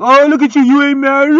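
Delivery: high, loud mocking taunt with a laugh; mocking gossip voice. Mocking people who ask if he's married, loud and high. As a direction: sneer it like the nosy people from his past. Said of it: "all they can do is talk shit about you".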